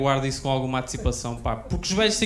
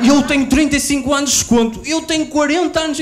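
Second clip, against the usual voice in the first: put-on high, loud old-man yell; old men shouting; 10 semitones higher, 8 dB louder than usual. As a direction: bellow it like a bragging pensioner. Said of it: "gritar o número de anos".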